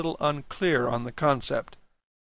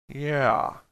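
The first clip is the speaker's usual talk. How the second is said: easy drawled yeah. A narrator's reading. Dan answers the narrator, drawled. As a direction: draw the word out lazily. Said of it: "he drawled".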